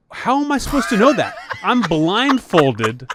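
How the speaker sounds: high, loud, incredulous retort, re-enacted; indignant protest. Blindfolded pledge protesting to his hazer, acted indignation.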